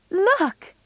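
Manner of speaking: excited. A narrator's reading. Meg shows her sister the party invitation, excited. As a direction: burst out with excitement. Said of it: "said Meg excitedly".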